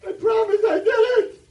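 very high, loud plea; kid's shouted plea. Retold or imitated. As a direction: shout it like a desperate little kid. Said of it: "She says".

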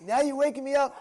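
high, loud mock outrage; exasperated homeowner. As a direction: protest like someone just woken up. Acts out woken homeowner's irritation.

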